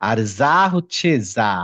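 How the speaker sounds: "জা" said slowly as a buzzing z sound; Arabic zay sound. Shown as a demonstration. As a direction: draw out the z in "জা". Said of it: "বর্গীয় জ হচ্ছে জিম". ("জা" ধীরে, জ-এর বদলে ঝাঁঝালো z ধ্বনিতে)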